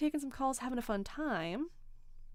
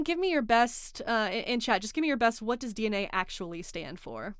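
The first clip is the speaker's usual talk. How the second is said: usual speaking voice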